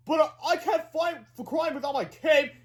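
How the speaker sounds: high, whining protest; distressed cry. A narrator's reading. Superhero frets about his cape, crying out high. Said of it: "cried Counter Defense".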